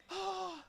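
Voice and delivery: very high teary "oh"; gasp, then let out a high whimper; mock emotional gasp. Viewer re-enacting reaction to the ending, high mock gasp. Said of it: "And I was like".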